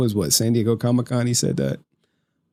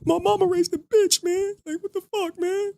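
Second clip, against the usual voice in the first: mock whiny voice; 4 dB quieter than usual. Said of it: "It's like".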